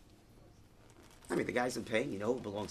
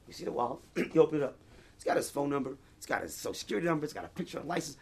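usual speaking voice

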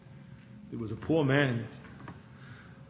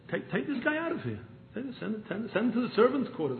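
much higher, agitated command; king's order; 8 semitones higher than usual. Retold or imitated. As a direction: throw him out with a high order. King orders stranger removed.

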